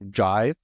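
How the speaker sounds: "Jai" said loud, sounding almost Australian; drawn-out Jai. Real, not acted. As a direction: say "Jai" long and firm, rhyming with eye. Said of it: "you sound so Australian when you do it".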